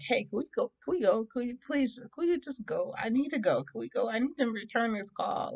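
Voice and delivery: high, repeated urgent begging, acted out; impatient pleading. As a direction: nag to leave right away. Begging family to leave, retold.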